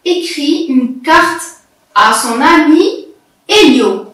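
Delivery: careful, spaced-out reading for beginners; teacher's measured read-aloud with long pauses. Shown as a demonstration. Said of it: "Je répète".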